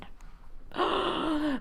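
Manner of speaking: a theatrical little gasp at the story; mock gasp. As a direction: gasp as if shocked by the plot twist. Dramatic reaction to game text.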